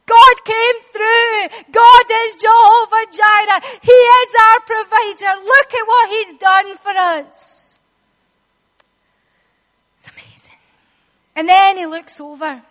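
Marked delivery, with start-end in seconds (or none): 0.0-7.8 loud, higher, slow joyful reassurance; overjoyed father soothing his son; Abraham rejoicing. Acted. Abraham to Isaac after the rescue, loud joyful reassurance. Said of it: "He's like". 10.0-10.6 breathy whispered awe. Real, not acted. Preacher marvelling to the church in a whisper. Whispered aside after the scene.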